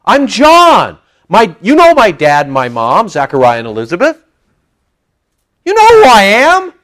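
high, loud exasperated protest; exasperated John. Retold or imitated. John tells the Pharisees who he is, exasperated. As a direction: make them see it's obvious. Voicing John's exasperated answer.

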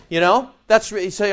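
usual speaking voice

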